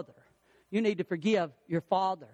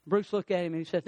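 normal talking voice

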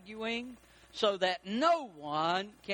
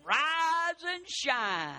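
slow, stretched high morning call; drawn-out singsong; 7 semitones higher, much slower than usual. Shown as a demonstration. Preacher quoting the morning wake-up call to the church, stretched slow. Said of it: "drawn-out saying".

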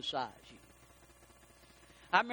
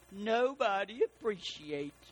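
mock martyr; slower than usual. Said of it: "Their favorite expression".

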